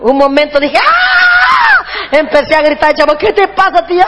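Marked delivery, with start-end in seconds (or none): 0.8-1.8 high, long scream of an overwhelmed aunt; frantic scream. Retold or imitated. Aunt overwhelmed by the kids, a long high scream. 3.2-4.0 mock child asking. Kid asking the aunt.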